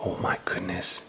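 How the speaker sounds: breathy whisper of shock, oh my goodness; whispered gasp. Acted. Reading to a small child, whispered astonishment. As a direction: hush it like a secret surprise.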